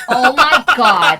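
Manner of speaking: loud, drawn-out exclamation; exasperated outburst. Real, not acted. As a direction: burst out in loud, slow disbelief. Exasperated at the dumbest comment.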